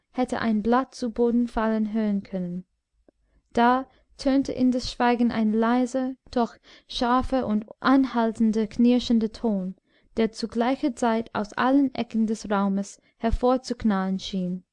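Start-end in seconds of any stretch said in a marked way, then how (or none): none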